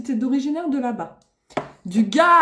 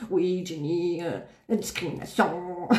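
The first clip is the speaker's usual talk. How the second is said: lightly mocking low, quieter voice; imagined complaining man; 8 semitones lower, 7 dB quieter than usual. Streamer imitating a male viewer's message, mock complaint. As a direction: put on a hint of a whining complainer. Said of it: "je vais recevoir un, un, un message".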